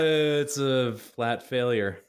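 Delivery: ordinary talk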